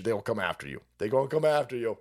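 ordinary talk

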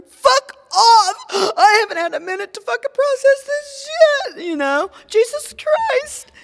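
very high, loud mock-crying protest; hysterical outburst. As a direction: wail back at your son, overwrought. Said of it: "and now I'm crying".

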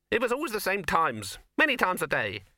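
high rabbit voice; rabbit character. Acted. Rabbit character keeps explaining.

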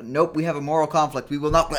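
loud, high mock protest; refusing army. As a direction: balk loudly on behalf of a whole army. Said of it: "the other armies are just like".

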